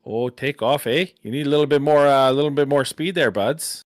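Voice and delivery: loud, hammed-up Canadian accent, eh. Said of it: "Canadian accent for all of you".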